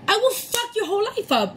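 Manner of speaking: angry threat. Real, not acted. Upset guest warning the rude hostess, angry threat. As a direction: threaten in a high, tight-held voice.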